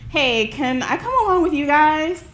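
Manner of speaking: acted-out perky request; eager request. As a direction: call out to friends, hoping to tag along. Asking her girlfriends to join them.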